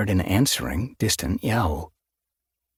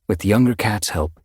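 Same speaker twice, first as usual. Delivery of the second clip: usual speaking voice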